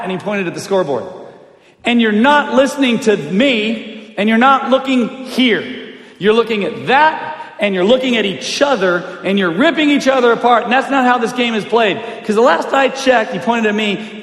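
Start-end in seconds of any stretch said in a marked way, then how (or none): 1.8-13.3 higher, emphatic, insistent lecture; retold coach's scolding. Coach dad to distracted bickering players, insistent scolding. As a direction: hammer each point like an angry coach.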